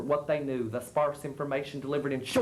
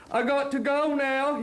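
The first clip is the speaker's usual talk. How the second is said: put-on ashamed old man; 9 semitones higher, 3 dB louder than usual. A narrator's reading. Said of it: "he said, ashamed".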